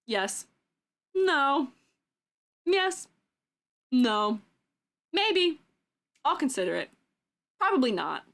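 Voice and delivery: lightly voiced talking app, each answer in its own pitch; flat yes and no like a toy answering at random. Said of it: "it randomly goes".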